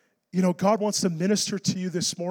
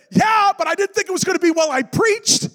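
loud, high comic shouting; exasperated protest; 10 semitones higher, 6 dB louder than usual. Retold or imitated. Preacher arguing with God about the passage he preached, loudly exasperated. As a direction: shout back in mock indignation.

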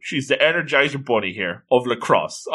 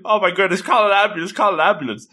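higher-pitched imitation of panicking; play-acted panic, pitched up; mock panic; 7 semitones higher, faster than usual. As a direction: act out a mild flap for help. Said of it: "she starts panicking".

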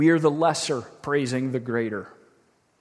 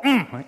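quick high hum, rising then dropping; mock appreciative "mm"; approving "mm"; 6 semitones higher, 3 dB louder than usual. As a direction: imitate someone humming hearty approval. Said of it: "gives it that verbal affirmation".

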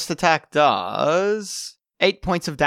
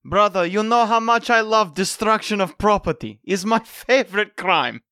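put-on higher-pitched Russian-accented character voice; 4 semitones higher than usual. Brother confiding in brother about wrecking property, Russian accent. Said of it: "a Russian accent".

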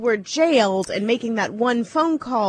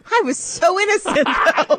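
mock criminal; 7 semitones higher than usual. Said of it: "That's exactly what every criminal said".

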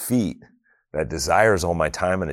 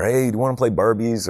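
put-on higher-pitched eager little kid asking; imitating eager kid; 6 semitones higher than usual. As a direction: play the kid tugging at dad to come play. Kids asking dad to play.

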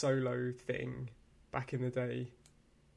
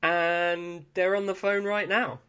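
slow dramatic reveal; 3 semitones higher, 4 dB louder, slower than usual. Acted.

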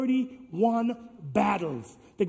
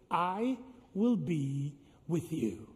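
slow, quiet, every word weighed; slow solemn refrain; 4 semitones lower, 6 dB quieter, much slower than usual. Shown as a demonstration. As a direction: lay it down softly and slowly. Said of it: "You say it with me now".

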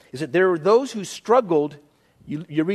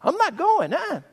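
mock Peter protest; 8 semitones higher than usual. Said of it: "I was doing the Peter thing".

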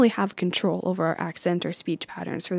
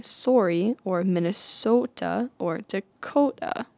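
Minnesota accent with long, drawn-out O's; slow Minnesota accent, stretching every O; slower than usual. Shown as a demonstration. Vlogger demonstrating Minnesota speech, Minnesota accent with drawn-out O's. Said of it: "tendency to draw out the O sound".

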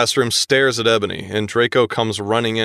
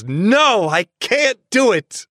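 dramatic tearful refusal; 8 semitones higher, slower than usual. Acted. Ebony refusing under curse.

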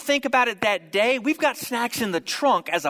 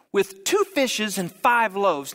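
normal talking voice

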